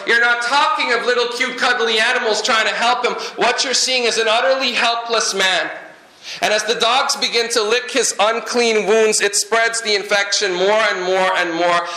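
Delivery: ordinary delivery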